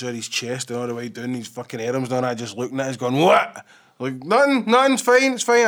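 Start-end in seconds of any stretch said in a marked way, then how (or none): 3.2-3.6 loud, high, one-word challenge; imitated barked challenge. Retelling the guy opening the door, loud challenge. Gruff householder at door, acted. 4.2-5.6 high, loud, hasty reassurance; mock panicked backtracking. Canvasser to man with giant dogs, mock panic.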